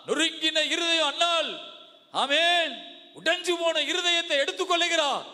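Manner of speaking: high, impassioned sermon voice; full-force preaching. Real, not acted. (உரத்த ஆவேசப் பிரசங்கம்)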